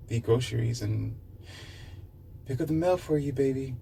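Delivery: high, soft, sugary wife voice; sweet homemaker wife. Retold or imitated. A stay-home wife sweetly greeting her husband, soft and high. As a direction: coo it high and gentle to your man.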